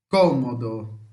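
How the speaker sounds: "Cômodo" strong on CO, falling away low; Italian KO-mo-do for "Cômodo", first syllable punched; antepenultimate stress. Shown as a demonstration. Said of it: "A antepenúltima". ("Cômodo" em italiano, com a primeira sílaba forte)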